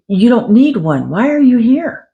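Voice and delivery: retold protest with high, emphatic peaks; challenging. Psychic reader turns away a client who needs no reading, challenging.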